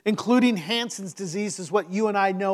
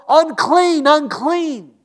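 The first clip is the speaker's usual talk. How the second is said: loud, high warning shout; 6 semitones higher, 6 dB louder, much slower than usual. Acted. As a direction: shout it out to warn people off. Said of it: "you would have to shout".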